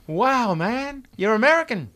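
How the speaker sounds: loud, high, delighted exclamation in English; delighted surprise. Real, not acted. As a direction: exclaim it as if thrilled to meet an American. Host meets English-speaking guest.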